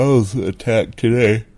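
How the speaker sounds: yawning out the news; mid-yawn. Real, not acted. Late-night host yawning while announcing he was attacked. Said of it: "I'm yawning".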